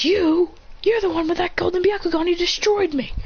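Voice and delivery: high-pitched acted outrage; shocked recognition. Enemy recognising the one who destroyed him, shocked accusation. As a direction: accuse him in startled disbelief.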